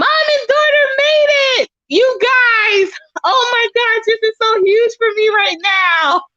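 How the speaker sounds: crying out with joy, barely holding it together; tearful joyful outburst. Real, not acted. Streamer thanks a supporter through happy crying shouts. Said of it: "I'm about to cry".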